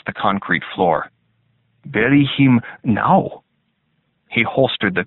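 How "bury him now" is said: slow, firm command; slow cold order. A narrator's reading. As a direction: draw it out as an order. Officer repeats order.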